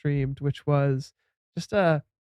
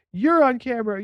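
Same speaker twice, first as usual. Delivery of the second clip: raised pitch, announced heads-up; mock warning; 7 semitones higher, 4 dB louder than usual. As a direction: warn passers-by they are being filmed, higher. Acted warning to people being filmed.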